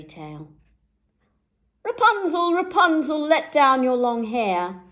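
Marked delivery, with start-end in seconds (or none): 1.8-4.8 loud, high call, slowing at the end. A narrator's reading. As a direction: call up to the tower. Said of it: "called the prince".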